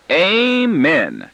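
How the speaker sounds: a mock-solemn, lifted amen; sarcastic. A narrator's reading. Daughter mocking her parents' truce, sarcastic amen. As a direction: drip sarcasm on one word. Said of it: "says my daughter sarcastically".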